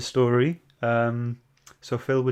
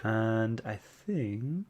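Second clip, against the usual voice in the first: ordinary talk, nothing marked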